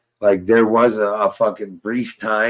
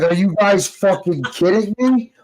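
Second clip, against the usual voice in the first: first take, pitched way up; outraged retort; 10 semitones higher than usual. Retold or imitated. Yelling at the cops in disbelief. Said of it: "I'm like".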